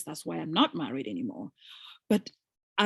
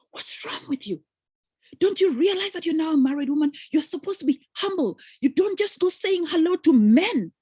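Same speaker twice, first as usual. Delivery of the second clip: higher, louder mock scolding husband; 8 semitones higher, 5 dB louder than usual. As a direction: lecture her as the jealous husband, rising in volume. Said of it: "He says".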